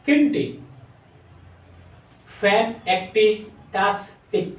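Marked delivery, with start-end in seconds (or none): 2.4-4.5 ফ্যান set apart, then টাস্টিক split as tas, tik; broken into syllables. Shown as a demonstration. Teacher counting syllables for the class, broken into pieces. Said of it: "এইভাবে ব্যঙ্গে ব্যঙ্গে".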